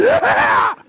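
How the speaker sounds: very high triumphant yell; acted shriek of joy; screaming excitedly. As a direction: whoop like a thrilled little boy. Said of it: "Just screaming while I was going".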